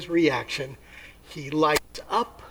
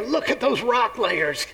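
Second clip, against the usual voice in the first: awed creationist; 5 semitones higher, slower than usual. Acted. Creationist at the Grand Canyon, delighted exclaiming. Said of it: "a creationist who believes".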